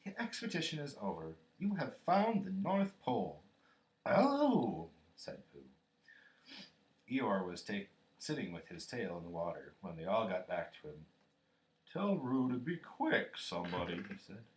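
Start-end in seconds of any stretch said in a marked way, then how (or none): 0.0-3.4 grand, measured pronouncement; solemn. A narrator's reading. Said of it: "said Christopher Robin solemnly". 4.0-4.9 high, loud "oh"; astonished little exclamation; surprised. A narrator's reading. Pooh astonished by the news. 11.9-14.0 ponderous donkey grumble; gloomy Eeyore. A narrator's reading. Eeyore complains with tail in water.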